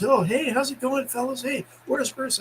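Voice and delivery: high, breezy retold greeting; Terry. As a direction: hail the guys in a lifted voice. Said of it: "So Terry goes".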